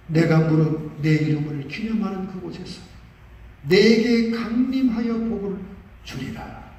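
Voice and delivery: slow solemn verse recitation; scripture reading. Acted. Preacher reciting the verse to the congregation, slow and solemn. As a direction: intone the promise, drawn out. Reads scripture, Korean then English.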